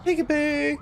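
high sing-song peekaboo; playful peekaboo. Pretend. Gamer popping out at an enemy, high playful peekaboo.

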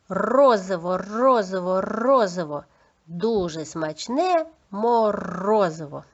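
rolled R in "Розово" and "морозиво"; clear R articulation. Shown as a demonstration. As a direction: trill each R of "Розово" for pupils to copy. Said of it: "Чітко вимовляєте звук Р".

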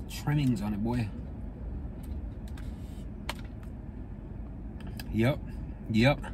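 speaking between bites; talking while chewing. Real, not acted. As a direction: mouth partly full of pastry. Seen 3: Bar at mouth at +0.5, chewing until ~4.6, then plain talking.

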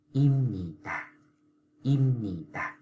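im nhì tà, slow and clear with a nasal m; drawn out im nhì tà, m not p; slow model reading. Shown as a demonstration. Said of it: "Cho nên bạn phải đọc là".

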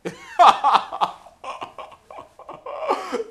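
long, loud, high-pitched laughter; drawn-out cackling laugh; hearty laugh. Acted. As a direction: crack up laughing. Laughs with friend on phone.

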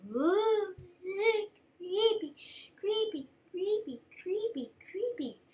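high, wavering ghostly creepy chant; spooky creepy voice. Retold or imitated. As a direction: moan it like a haunted ghost. Voicing spooky reaction in dream.

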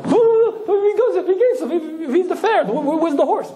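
put-on high, stammering, half-laughing old-timer; confused Shmuel. Shmuel in a moving car asking where the horse is, high and stammering. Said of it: "and I begin driving".

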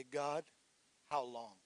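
very soft, low how long; hushed plea to God. Shown as a demonstration. Pastor voicing the congregation's prayer, hushed and low. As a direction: let the question fall away almost inaudible. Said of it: "today we say".

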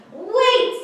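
high, loud startled "Wait"; mother duck calling out; Mama Duck's sharp call. Acted. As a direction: stop him short as the mother duck. Mama Duck stops the fox.

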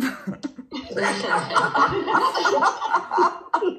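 long real laughter. Real, not acted. After quoting her webinar intro, real laughter. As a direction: burst out laughing.

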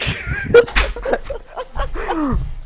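shrieking laugh; high burst of laughter. Real, not acted. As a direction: cackle helplessly. Friend's big win, losing it.

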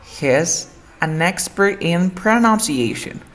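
slow reading, "exper-in"; "expert in" joined, read slowly; linked example. Shown as a demonstration. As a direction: drop the T in "expert in". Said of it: "voy a tratar de leer".

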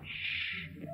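unvoiced shush. Fibber hushing Billy so the mouse won't hear, whispered. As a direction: hush someone urgently.

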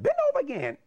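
high put-on order; high-pitched retold command; imitating the principal. Principal orders another round of paddling.

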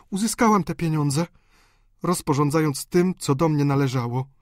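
understated weeping outburst; tearful cry. A narrator's reading. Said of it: "zalewając się łzami".

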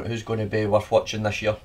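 ordinary talk

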